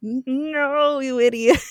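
high-pitched mock exasperation; exasperated scolding. Buffy scolds Riley for smashing the wrong thing, exasperated. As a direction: scold a clueless partner, playfully overdone.

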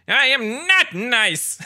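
loud, high, drawn-out villain impression; Skeletor. Podcaster quoting a favorite villain line, slow loud Skeletor voice. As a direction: proclaim it slowly in a high villain voice. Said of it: "we also get my other favorite".

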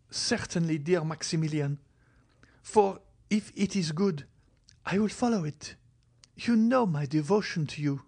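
put-on high, airy young woman's voice; Valentine, devoted. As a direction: promise earnestly, pitched well up. Valentine pledges to follow advice.

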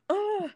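high mock groan of annoyance; frustrated groan. Cheerleader retelling missing her photo, frustrated groan. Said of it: "I was so mad".

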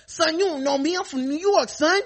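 high, mocking New York tough guy; hammed-up New York bravado, pitched high; tough New Yorker. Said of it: "they hit that".

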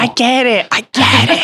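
high put-on realization voice; mock epiphany. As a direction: play it like a sudden sarcastic epiphany. Mock epiphany about kids.

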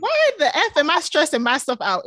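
fed-up retold outburst; exasperated. Mom venting over squabbling kids, exasperated.

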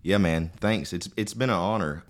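ordinary delivery

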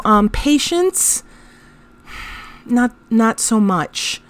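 ordinary talk

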